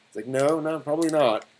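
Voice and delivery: ordinary delivery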